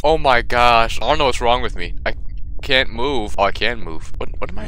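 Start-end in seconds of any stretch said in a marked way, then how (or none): none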